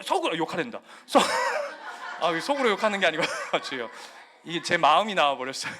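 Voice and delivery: laughing through the words; real laughing. Real, not acted. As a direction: break up at your own slip. Laughs at own slip of tongue.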